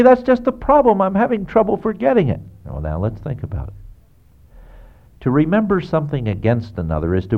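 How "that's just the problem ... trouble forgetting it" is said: much higher, whiny objection; mock complaining listener. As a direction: play the protesting churchgoer, pitched way up. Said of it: "But you say".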